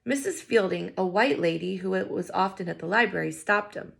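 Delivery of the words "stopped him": usual speaking voice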